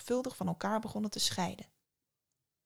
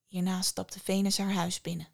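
plain reading voice

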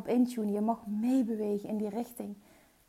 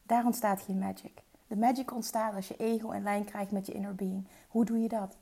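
ordinary talk

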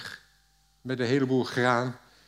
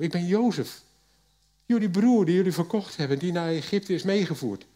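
higher, louder, moved; emotional reveal; 6 semitones higher, 3 dB louder than usual. A narrator's reading. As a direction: burst out with the news, choked up. Joseph reveals himself to brothers. (hoger en luider, ontroerd)

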